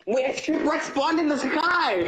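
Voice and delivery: high-pitched, laughing disbelief; amazed discovery. Real, not acted. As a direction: call it out in astonishment. Amazed at odd game find.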